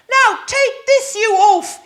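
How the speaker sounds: mock the giant from above; knight's taunt. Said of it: "he said".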